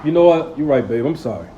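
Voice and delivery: low, soft, giving in gently; a good man quietly saying sorry; husband softly apologizes to wife; apologetic husband. Acted. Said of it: "he would say".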